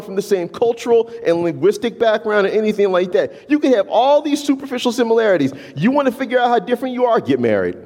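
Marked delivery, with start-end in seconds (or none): none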